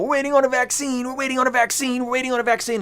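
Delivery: high mocking singsong, repeated like a chant; mocking the crowd. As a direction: parrot everyone's hopeful line in a high silly voice. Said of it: "Everybody's talking about".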